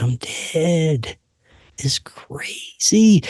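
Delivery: awed slow whisper. Real, not acted. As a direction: breathe it out in disbelief. Awed whisper while walking dead.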